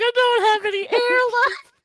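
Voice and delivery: high, loud hysterical gasp of words; hammed-up breathless meltdown; mock out of air. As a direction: squeeze it out as if out of breath. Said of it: "Can you just breathe?".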